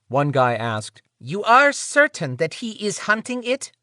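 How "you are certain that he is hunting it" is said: high, pinched nasal male voice; nasally voice. Acted. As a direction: ask it through the nose, higher. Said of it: "In a nasally voice".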